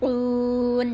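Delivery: উন held long on a level note; drawn-out recitation. Shown as a demonstration. Teacher finishes the demonstrated word, long level উন. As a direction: stretch the vowel of উন evenly.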